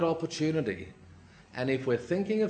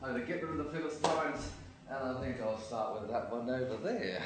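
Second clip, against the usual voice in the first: quiet musing, trailing softer; thinking to himself; 7 dB quieter than usual. Acted. Samson sizing up the Philistine town, quietly musing. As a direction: think it through under your breath. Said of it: "he's walking and thinking".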